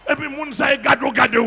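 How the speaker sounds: loud fervent preaching; shouting sermon. Real, not acted. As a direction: rouse the congregation. Preaching at full force.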